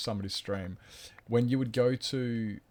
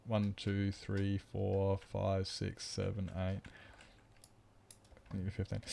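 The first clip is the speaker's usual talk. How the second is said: counting while trailing softer and softer; fading count; 8 dB quieter, slower than usual. Acted. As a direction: let the numbers fade away.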